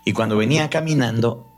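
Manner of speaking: usual speaking voice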